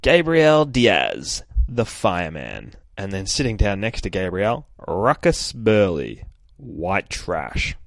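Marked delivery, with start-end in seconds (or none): 0.0-2.8 announcer name reveal. Acted. Reading out character name caption. 4.9-7.8 announcer name reveal. Acted. Reading out character name caption.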